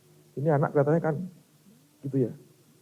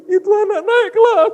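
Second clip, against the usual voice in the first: loud, very high imitated crying; hammed-up high-pitched sobbing cry; mock bawling; 10 dB louder than usual. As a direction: wail it like a blubbering pastor. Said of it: "nangis lagi".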